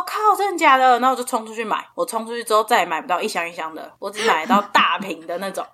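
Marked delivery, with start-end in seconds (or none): 0.0-0.9 surprised. Retold or imitated. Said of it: "然后我说".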